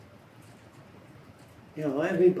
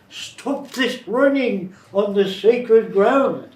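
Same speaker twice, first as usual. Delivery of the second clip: loud, slow, high scolding; slow loud old man scolding kids; 7 semitones higher, 9 dB louder, much slower than usual. Retold or imitated. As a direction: bellow it at the children, slow. Repeats the old man's scolding.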